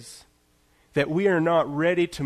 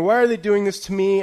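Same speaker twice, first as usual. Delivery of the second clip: louder, higher mock-dramatic whine; dramatic complaint; 5 semitones higher, 6 dB louder than usual. Retelling his tech outrage, mock-dramatic whine. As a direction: ham up the outrage at the update. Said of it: "it's a dramatic moment".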